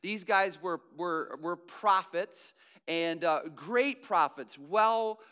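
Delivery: loud, driving sermon voice; a pastor pressing the point home; preaching. Acted. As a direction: preach with rising force, leaning on key words. Full preaching delivery.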